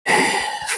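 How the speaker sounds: long breathy resigned sigh; weary sigh. Retold or imitated. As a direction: let out a tired sigh. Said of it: "And he's like".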